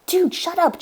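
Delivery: snapping. Acted. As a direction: snap at a giggling friend. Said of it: "Josh snapped".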